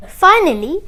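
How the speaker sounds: ordinary delivery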